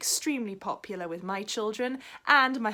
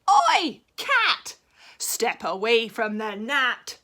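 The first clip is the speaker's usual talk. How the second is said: frog shouting; 3 semitones higher, 9 dB louder, much slower than usual. Acted. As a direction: bark the order at the cat, slow on the last words. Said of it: "said the frog".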